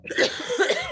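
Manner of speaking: sharp loud cough; harsh real cough; coughing fit. Real, not acted. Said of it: "coughing while I'm talking".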